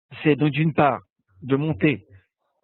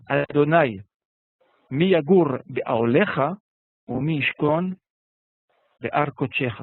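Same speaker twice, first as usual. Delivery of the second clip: measured, slow reading of the Hebrew verse; slow liturgical reading; slow Hebrew recitation; slower than usual. Shown as a demonstration. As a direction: lay out each Hebrew word deliberately.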